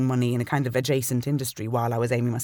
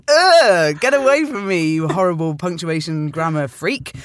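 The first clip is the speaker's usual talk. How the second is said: loud, high, disgusted brush-off; mock disgust; 4 semitones higher, 8 dB louder, slower than usual. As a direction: recoil in mock horror at a pedant. Imagined rude reply to grammar email.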